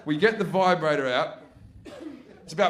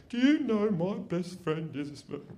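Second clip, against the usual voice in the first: mock indignant do-gooder; bleeding heart; 4 dB quieter than usual. Offended audience member confronts comedian after a gig, mock indignant. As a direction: imitate an offended complainer, labouring each word. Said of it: "some bleeding heart come up to you".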